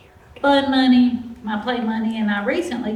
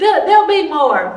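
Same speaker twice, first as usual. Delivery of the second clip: laughing; 6 semitones higher, 9 dB louder than usual. Real, not acted. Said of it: "Say it again and we'll laugh".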